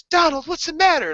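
high, loud mom voice; high-pitched urgent question; worried mother. Retold or imitated. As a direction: imitate an alarmed mom calling out. Mother voiced in retold scene.

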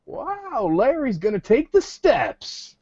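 high, drawn-out swooping wow; mock amazement. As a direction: imitate a friend's exaggerated astonishment, stretching it out. Sam's mock amazement at Larry.

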